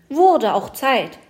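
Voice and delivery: slow, high and loud cheer; jubilant. A narrator's reading. Fir tree rejoicing as it is dug up, slow loud cheer. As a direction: finally, shout it out. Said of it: "die Tanne jauchzte".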